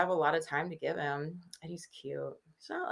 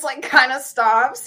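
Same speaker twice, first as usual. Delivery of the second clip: usual speaking voice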